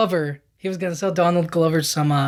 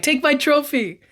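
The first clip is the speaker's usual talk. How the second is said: mock Flash; 8 semitones higher than usual. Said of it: "Flash Thompson's like".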